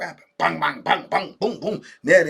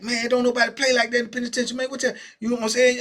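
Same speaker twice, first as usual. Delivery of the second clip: higher, fast no-nonsense scold; stern scolding; 6 semitones higher, faster than usual. Retold or imitated. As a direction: lay down the rule, serious. Serious celly scolding, fast.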